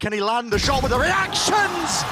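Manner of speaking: rising excited play-by-play; esports caster. Real, not acted. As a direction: build the call as the fight unfolds. Live play-by-play call.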